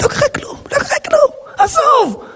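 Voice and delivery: high whiny half-sob; mock crying. Rabbi mimics his hurt self crying it off, high and whiny. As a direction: ham up a wounded cry. Mock distress talking to himself.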